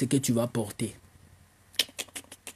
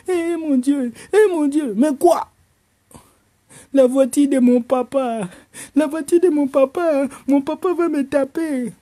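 high, loud panicked whining; panicked wail; 11 semitones higher, 5 dB louder than usual. Acted. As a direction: whine in terror of dad. Boy panicking over father's car. (plainte paniquée, aiguë et forte)

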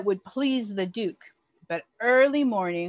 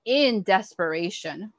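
plain reading voice

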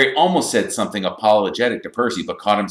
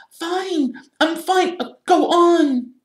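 very high squeaky reply; distressed Hermione; 6 dB louder, slower than usual. A narrator's reading. Hermione brushing off Harry through the door, high distressed voice. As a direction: squeal a reassurance, louder at the end.

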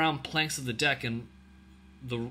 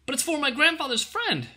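put-on very high girlish protest; crying out; 14 semitones higher, 4 dB louder than usual. A narrator's reading. Ellen to the soldier taking her bread, high cry. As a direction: protest like a desperate child. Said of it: "cried Ellen".

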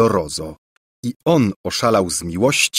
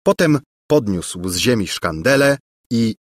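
usual speaking voice

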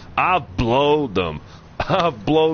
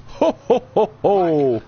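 Santa laugh; 2 semitones higher than usual. Pretend. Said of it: "It's Santa Claus".

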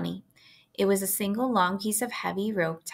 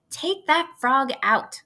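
slow, higher, firm command; bossy order; 6 semitones higher, 4 dB louder, slower than usual. A narrator's reading. Girl ordering brother to remove frog, bossy. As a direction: lay down the law to a little brother. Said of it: "ordered Fern".

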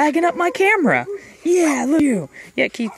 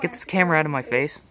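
voicing the monkey; 11 semitones lower, 5 dB quieter, much faster than usual. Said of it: "He's like".

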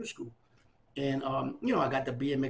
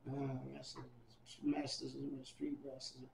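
thinking aloud; 17 dB quieter, slower than usual. Retold or imitated. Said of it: "I'm really thinking".

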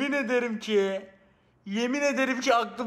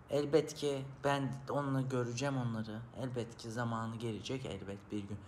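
much softer, lower mock heartfelt plea; imitating a sentimental streamer; 8 semitones lower, 9 dB quieter than usual. Mocking streamers who address fans, soft and low. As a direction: hush it down like a tearful streamer. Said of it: "böyle yapar".

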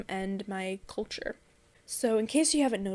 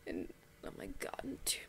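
breathy under-the-breath aside, much softer; whispered aside; 3 semitones higher, 15 dB quieter, faster than usual. Real, not acted. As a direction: mutter it to yourself, barely audible. Flustered aside while counting years.

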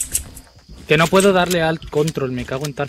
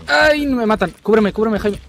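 panicking gamer; 7 semitones higher than usual. Real, not acted. Gamer under fire, calling cover.

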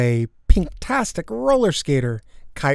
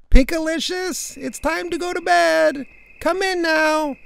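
high, carrying parental call; bellowing. A narrator's reading. Parents calling daughter to bed, high bellow. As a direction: holler a child in from the door. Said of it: "bellowed voices from the door".

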